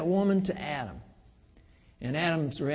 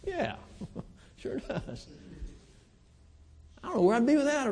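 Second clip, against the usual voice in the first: normal talking voice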